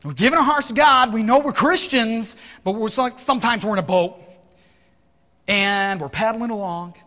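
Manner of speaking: forceful preaching. Real, not acted. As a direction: preach it out high and loud.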